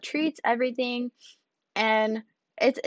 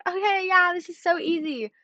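breezy group agreement; 8 semitones higher than usual. Retold or imitated. Acting out the class's breezy agreement.

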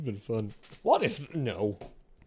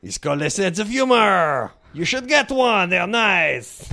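loud, higher mock French accent; 4 semitones higher, 6 dB louder, slower than usual. Player giving lines to guess, loud French accent. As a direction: put on a hammed-up French accent, loudly. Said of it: "French. Yes".

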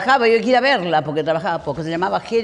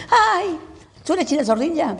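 squealing much higher with delight; enthusiastic fan; 11 semitones higher than usual. Retold or imitated. Young fan meeting China at the cocktail, gushing much higher. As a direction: gush like a starstruck young fan. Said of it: "así muy entusiasta".

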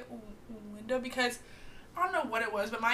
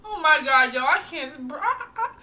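put-on loud, high, breathless drunk complaint; mock a drunk friend who can't breathe; drunk panic; 4 semitones higher, 8 dB louder than usual. Friend imitating drunk Hannah on the riverbank, loud and high. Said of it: "Hannah at this point is like".

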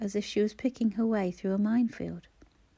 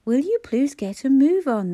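loud, high, impatient demand; 5 semitones higher, 9 dB louder than usual. A narrator's reading. Impatient businessman demands the queue move, loud and high. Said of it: "the suit demanded from behind Clara".

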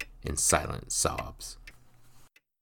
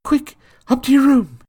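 much higher and louder, hurried; anxious urgent order; 14 dB louder than usual. Acted. Frightened mother sending her son upstairs. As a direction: rush him away in fear. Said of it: "her voice quivering with anxiety".

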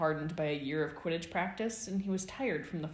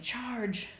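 higher-pitched, contained mock yell; yelled battle cry; 7 semitones higher than usual. A narrator's reading. Sirius running round the students, mild battle-cry yell. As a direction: call the charge without full volume.

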